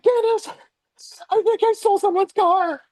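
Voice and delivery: high, panicky mock phone call; comically panicked re-enactment; retold meltdown. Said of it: "having a little meltdown on the phone".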